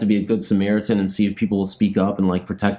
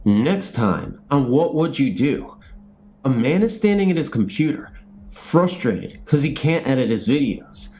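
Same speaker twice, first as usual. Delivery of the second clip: TV show narration; 4 semitones higher than usual. Acted. Vlogger narrating his made-up show outro, announcer style. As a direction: introduce the episode like a show host.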